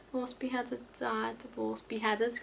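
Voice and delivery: very soft, recited under the breath; quiet rote recitation of a school rhyme; muttered rhyme. Real, not acted.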